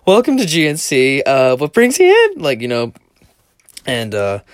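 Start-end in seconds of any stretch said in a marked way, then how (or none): 0.0-2.4 high, loud put-on retail voice; fake customer-service voice. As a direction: chirp it like a fake-polite shop clerk. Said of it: "the fakest voice ever".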